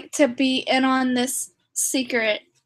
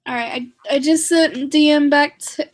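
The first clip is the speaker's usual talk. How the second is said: normal talking voice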